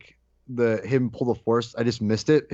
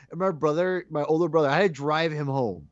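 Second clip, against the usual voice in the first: normal talking voice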